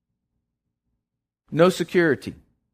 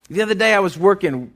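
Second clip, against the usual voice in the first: normal talking voice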